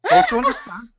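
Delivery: strict, staccato mock German accent. Guest imitating German staccato speech, mock German. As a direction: clip it like a stern German. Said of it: "the Germans, very strict, staccato".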